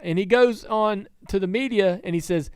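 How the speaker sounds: normal talking voice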